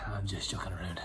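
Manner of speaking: soft whispered repeat; whispering. Shown as a demonstration. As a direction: whisper it to yourself.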